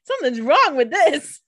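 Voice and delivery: high, loud "ew" of revulsion; mock disgust. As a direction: play a comically grossed-out wolf. Wolf tastes bad blood, acted.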